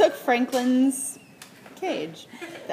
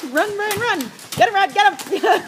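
excited yelling during a Nerf fight; being chased; 9 semitones higher, 6 dB louder than usual. Real, not acted. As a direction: shout it out mid-chase, loud and high. Nerf gun fight in office.

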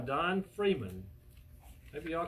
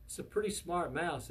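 usual speaking voice

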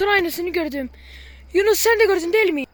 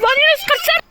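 panicked yell; 11 semitones higher, 8 dB louder than usual. Real, not acted. Fleeing the "ghost", yelling.

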